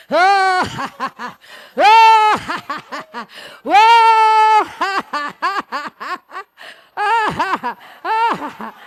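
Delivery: high, held "oh" bursting into cackles; howl, then let loud laughs tumble down; big exercise laughter. Shown as a demonstration. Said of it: "laugh for one minute".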